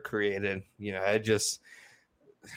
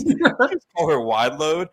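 slow, higher mock-serious line; deadpan Hal, slowed and raised; quoting Hal; 6 semitones higher, 5 dB louder, slower than usual. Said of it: "And he goes".

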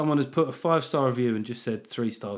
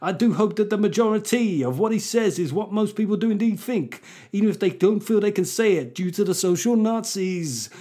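high mock garage MC accent; hammed-up garage MC voice, pushed up high; 5 semitones higher, 4 dB louder than usual. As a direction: chant it like a put-on UK garage MC. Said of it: "let's do this in a garage accent".

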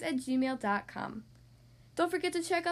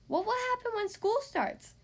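higher, anxious little skeleton; worried skeleton voice; 8 semitones higher than usual. Acted. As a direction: fret like a nervous kid. Skeleton worries about school.

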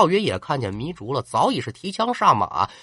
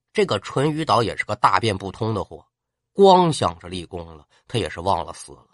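ordinary delivery, nothing marked

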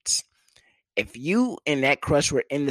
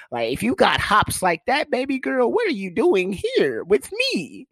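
ordinary talk, nothing marked

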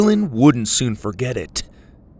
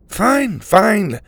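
sarcastic; 10 semitones higher, 5 dB louder than usual. A narrator's reading. Mac gives up, sarcastic.

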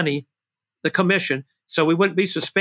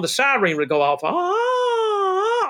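siren wail; 10 semitones higher, much slower than usual. Retold or imitated. Imitating the siren.

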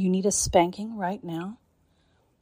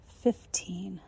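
ordinary delivery

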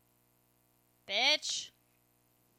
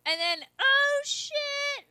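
usual speaking voice

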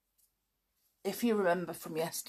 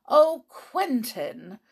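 reproachful; 6 semitones higher, 6 dB louder, slower than usual. Acted. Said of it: "said his wife reproachfully".

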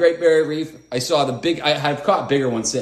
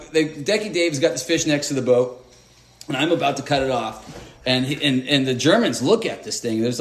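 usual speaking voice

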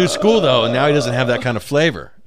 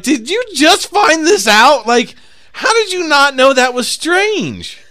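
incredulous; 10 semitones higher, 5 dB louder, slower than usual. Retold or imitated. Retold disbelief at her.

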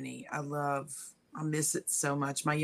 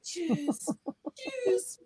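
very high chirpy cheers; sing-song cheers. Retold or imitated. Recalling German toasts, high sing-song cheers.

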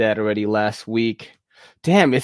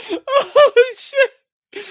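surprised yelp; 6 dB louder than usual. Real, not acted. Reacts to a friend joining.

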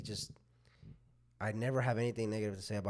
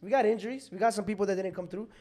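higher and louder arguing; heated; 8 semitones higher, 4 dB louder than usual. Real, not acted. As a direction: push back hard on the point. Heated football argument.

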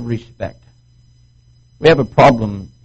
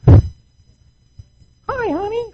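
mock-sultry high hello; seductive wife. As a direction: coo like a wife welcoming her husband. Wife greeting husband at door.

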